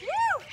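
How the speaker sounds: high, loud whoop; high cheering woo. Real, not acted. Cheer during lunge set.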